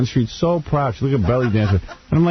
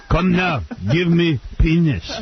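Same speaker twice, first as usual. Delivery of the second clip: put-on high, slow German accent; hammed-up German accent, high and slow; mock German accent; 6 semitones higher, slower than usual. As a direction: demand it like a German vamp.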